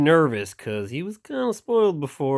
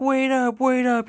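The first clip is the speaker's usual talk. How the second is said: much higher, pleading voice; high chasing call; 13 semitones higher, slower than usual. Acted. People running after the boat, high-pitched calling. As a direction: call after him in a squeaky voice.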